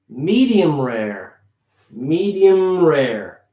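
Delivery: medium rare, drawn out slowly, holding the M of "medium"; slow model pronunciation; slow, carried M. Shown as a demonstration. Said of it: "Carrying that M".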